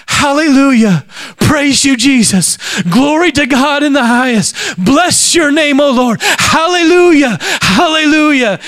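high, slow, fervent cries of hallelujah; ecstatic praise. Retold or imitated. Woman in the congregation praising God aloud, fervent high worship cries. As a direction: lift the voice up in rapturous worship, drawing it out.

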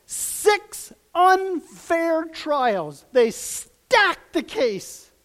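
very slow, high and loud stress; slow, weighty preaching emphasis; slow emphatic. Real, not acted. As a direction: drag out each word of six unfair trials. Preacher stresses unjust trials.

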